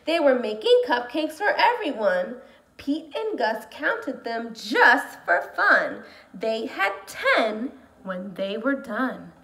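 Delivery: slow, animated read-aloud; storytime reading. Acted. Teacher reading a picture book to her class, slow and lively.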